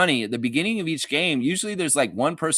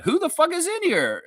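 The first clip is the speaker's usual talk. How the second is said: mock baffled outsider. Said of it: "the guy will be like".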